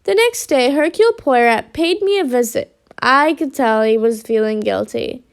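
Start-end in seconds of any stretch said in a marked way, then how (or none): none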